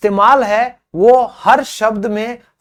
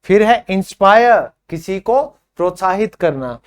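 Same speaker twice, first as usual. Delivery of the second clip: ordinary talk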